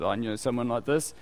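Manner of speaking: ordinary talk, nothing marked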